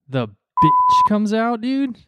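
scandalized, stretched-out query; incredulous echo. Real, not acted. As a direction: repeat it back in drawn-out disbelief. Echoes the storyteller's word in disbelief.

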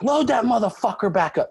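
high, angry order; fired-up demand. Retold or imitated. Lifter demanding the bar be reloaded, high and furious. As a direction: bark it at them, pumped and furious. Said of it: "I start losing my mind".

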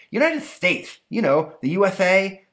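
put-on high kid voice; boy explaining. Stanley explaining the country to his monster, high. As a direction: spell it out eagerly. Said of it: "Stanley explaining".

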